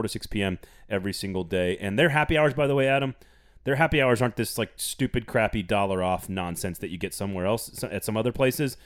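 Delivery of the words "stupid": ordinary talk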